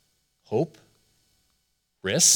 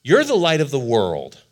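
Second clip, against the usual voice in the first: proclaiming; 4 semitones higher, 7 dB louder than usual. Acted. Jesus proclaims, repeated later plainly.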